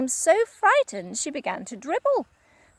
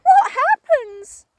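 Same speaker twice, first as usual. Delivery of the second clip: much higher, louder frightened gasp; gasped what; 11 dB louder, slower than usual. Acted. Wife terrified by the shrinks, gasping cry. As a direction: gasp it out in terror. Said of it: "gasped Missus Twit".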